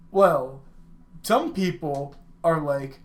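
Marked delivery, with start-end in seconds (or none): none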